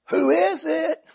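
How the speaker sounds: high voice calling through the door; imitated householder. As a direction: mimic someone answering the doorbell. Startled householder answers doorbell.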